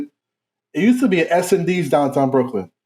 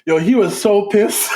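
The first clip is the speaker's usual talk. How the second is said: ordinary talk, nothing marked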